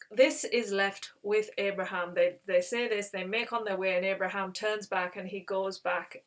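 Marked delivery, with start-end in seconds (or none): none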